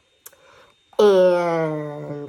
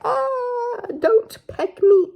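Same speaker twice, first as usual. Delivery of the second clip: high squeaky plea; high, louder mock panic; scared caterpillar; 4 dB louder, slower than usual. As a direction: plead like a tiny frightened caterpillar. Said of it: "the caterpillar's like".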